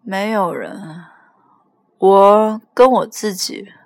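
put-on slow, drawn-out drunken reply; slurry-slow drunk on the phone. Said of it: "我真是醉了".

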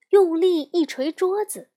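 plain reading voice

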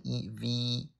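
"E V" with a long, drawn-out ee; long E sound. Shown as a demonstration. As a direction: stretch the ee slowly in "E V". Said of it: "E E读长音E".

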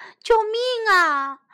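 high, drawn-out call; shouting for help. A narrator's reading. Otters call for rescue from developers, shouting. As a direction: call urgently for rescue, voice lifted. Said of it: "水獭们喊着".

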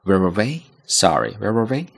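where were we with a rising questioning tune; 带一点语调的 where were we; with a bit of tune. Shown as a demonstration. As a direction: let where were we lift like a real question. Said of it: "稍微会用一个声调".

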